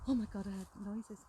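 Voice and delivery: ordinary delivery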